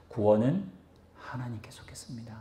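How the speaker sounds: hushed whispered declaration. Real, not acted. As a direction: whisper the key line, almost no voice. Sermon conclusion, hushed key line.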